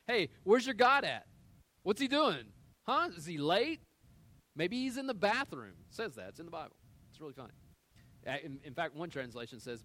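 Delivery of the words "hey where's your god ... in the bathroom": high, loud jeering call; mocking taunt. Elijah taunting the prophets of Baal, loud mocking jeer. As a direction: jeer at them like they're fools, loud and high. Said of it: "he starts to taunt them".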